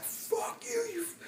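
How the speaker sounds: high, soft strained mimicry of a furious man; squeaky falsetto rage, played for laughs; mock angry neighbor. Neighbor screaming at his girlfriend, high mock fury. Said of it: "he's just like".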